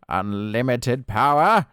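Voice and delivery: slow, loud sinister Sith rasp; Palpatine voice. Retold or imitated. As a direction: drag out power like an evil emperor. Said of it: "I talk like Palpatine".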